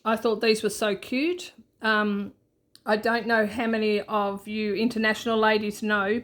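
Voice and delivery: ordinary delivery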